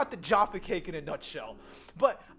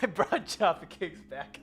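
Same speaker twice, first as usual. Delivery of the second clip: ordinary talk, nothing marked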